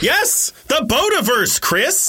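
high, drawn-out reminder; mock exasperation. Real, not acted. Co-host steering Chris back on track, high exasperated reminder. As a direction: stretch it out high, as if to someone slow.

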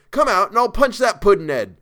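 loud, high Cockney threat; angry threat. Retold or imitated. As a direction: dare him to a fight in Cockney. Servant acts out his own threat.

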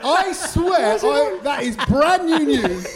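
high, laughing disbelief; slow, emphatic amazed protest; astonished. Real, not acted. Startled by tour announcement, protests.

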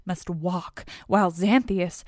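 ordinary delivery

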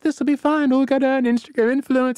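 high breezy carefree voice; mock naive girls. Mocking the smugglers' carefree plans. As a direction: play the clueless girls, all breezy and upbeat. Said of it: "They're just like".

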